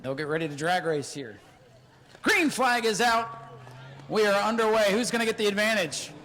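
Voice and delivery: lightly voiced play-by-play; a hint of PA race call; race announcer. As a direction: announce the next heat to the stands. Announcer calling next race.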